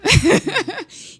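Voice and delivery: high, loud burst of laughter; big high-pitched laugh. Real, not acted. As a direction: laugh out loud at your own story. Laughs at own early pitch time.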